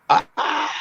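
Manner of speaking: high, sudden gasp of fright; a high scared ah; frightened gasp. Retold or imitated. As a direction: yelp in panic. Freaked out gasp.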